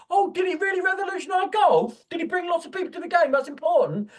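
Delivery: high, loud mocking tirade; sarcastic rant. Real, not acted. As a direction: pour scorn on it at full pitch. Sarcastic rant against golf.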